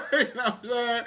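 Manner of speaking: high laughing question. Real, not acted. Laughs at his bonjour joke.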